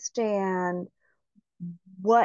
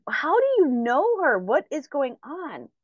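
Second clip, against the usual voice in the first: baffled questioning; 6 semitones higher than usual. Retold or imitated. Retold friend's baffled questioning.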